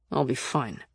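low, soft stubborn reply; quiet, dug-in insistence. A narrator's reading. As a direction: brush off concern, stubbornly. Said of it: "he said stubbornly".

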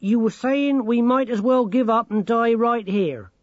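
put-on high, loud old granny character voice; mock-helpful granny. A narrator's reading. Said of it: "said Granny Morky helpfully".

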